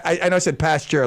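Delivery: ordinary talk, nothing marked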